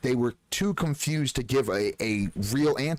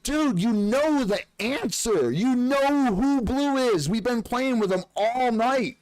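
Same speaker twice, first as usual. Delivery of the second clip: accusing callout; 10 semitones higher than usual. Retold or imitated. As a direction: drag out the callout as if he's caught them. Calling out a suspect player.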